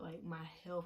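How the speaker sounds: normal talking voice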